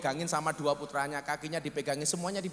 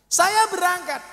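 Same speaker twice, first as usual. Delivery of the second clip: loud, high preacher shout; 12 semitones higher, 8 dB louder than usual. Real, not acted. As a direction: belt it out to the crowd. Preacher shouting his arrival. (teriak keras)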